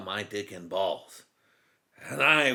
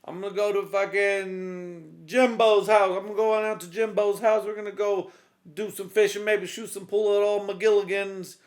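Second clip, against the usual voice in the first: higher, louder easygoing husband voice; breezy like heading out for the day; cop to wife; 8 semitones higher, 7 dB louder than usual. Retold or imitated. Cop telling his wife he's going out, high and loud. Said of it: "He's like".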